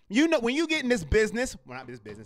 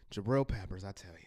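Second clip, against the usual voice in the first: exasperated sigh; 13 semitones lower, 16 dB quieter, faster than usual. Real, not acted. Exasperated sigh over player.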